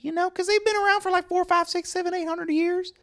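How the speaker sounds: high-pitched awkward store guy; squeaky oddball coworker voice; high character voice. Acted. Skit character Clint, high voice.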